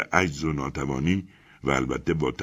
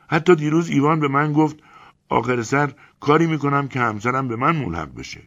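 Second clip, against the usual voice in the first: higher, louder hopeful telling; eager reassurance; 10 semitones higher, 7 dB louder than usual. Acted. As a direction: narrator tells Elena of Ivan's hopes, eager. Narrator quotes Ivan hopefully.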